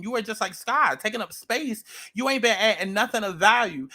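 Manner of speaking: normal talking voice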